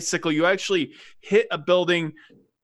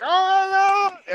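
high, loud cry of alarm; alarmed gnomes; 4 dB louder than usual. Retold or imitated. Gnome crowd watching the jump go wrong, high alarmed cry. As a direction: gasp it out like a crowd seeing a crash. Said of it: "all the gnomes are like".

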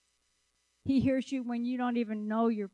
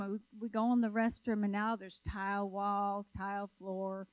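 normal talking voice

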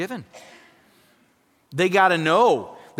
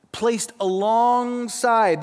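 very slow, weighted; 4 semitones higher, much slower than usual. Shown as a demonstration. Preacher repeating the definition to the congregation, drawn out. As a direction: drive the phrase home slowly. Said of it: "It means placed alongside".

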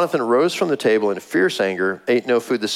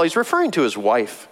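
ordinary talk

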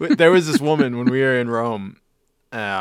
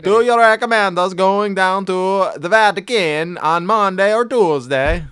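higher, louder mock foreign accent; Borat-ish accent; 7 semitones higher, 6 dB louder than usual. Tourist talking to Italian woman, Borat-like accent. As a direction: ham it up like Borat. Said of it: "starts sounding like Borat".

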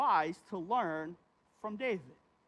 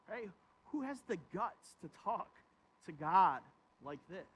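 normal talking voice

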